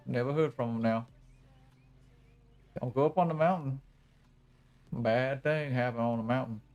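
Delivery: put-on old backwoods hillbilly Cajun accent, spooky warning. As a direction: warn them off the mountain in a thick hillbilly Cajun accent. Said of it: "it's like backwoods hillbilly plus, like, Louisiana Cajun".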